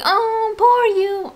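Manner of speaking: high, cooing imitation of sympathy; mock pity. Imitating people who pity the speaker, mock pity. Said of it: "I don't want pity".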